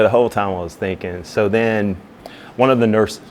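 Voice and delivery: usual speaking voice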